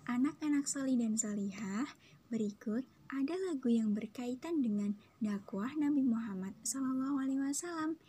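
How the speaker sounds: usual speaking voice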